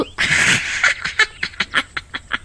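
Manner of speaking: high breathy witch cackle; gleeful cackle over her trapped prey; long airy evil cackle. Acted. Witch laughs over Lallu.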